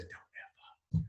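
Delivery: silent, slow, voiceless mouthing of the words; mouthed. Retold or imitated. Jordan mouthing a warning as the elevator closes, voiceless whisper. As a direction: barely breathe it out, no voice at all. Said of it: "And he mouths it".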